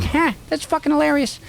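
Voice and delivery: high-pitched mock amusement; Burr laughing it off. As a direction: toss it off like a big amused guy. Burr reacting, laughing at bit.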